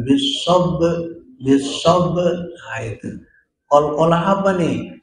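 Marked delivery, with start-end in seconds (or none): 0.0-2.2 bis sob with the final b bounced out hard; qalqalah bounce. Shown as a demonstration. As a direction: give the b of sob an echoing bounce. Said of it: "kita kol kolahkan". (bis sob dengan pantulan qalqalah pada ba)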